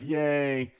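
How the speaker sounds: playful yay; mock cheer. Real, not acted. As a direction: cheer the score with light irony. Cheering their dance score.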